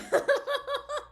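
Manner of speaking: squealing high laugh of disbelief; high-pitched laugh. Real, not acted. Vlogger laughing at her ruined plans, shrill laugh. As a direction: laugh in helpless exasperation.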